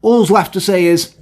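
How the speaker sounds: ordinary delivery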